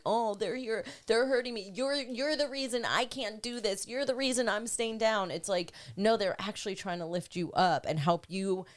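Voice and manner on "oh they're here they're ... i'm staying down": higher-pitched mock victim complaint; victim mentality. Speaker voicing someone blaming others for pushing buttons, mock victim. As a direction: play a put-upon victim, pitched up. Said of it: "to be a victim mentality".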